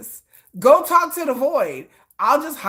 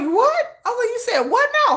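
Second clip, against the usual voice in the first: high squeaky double take; mock disbelief; 12 semitones higher than usual. Creator retelling her reaction to a comment, high mock shock. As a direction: act scandalized, voice jumping up.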